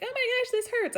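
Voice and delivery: high, loud whiny complaint; whiny patient. Retold or imitated. Mocking a whiny labor patient, high and whiny. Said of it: "really whiny about being like".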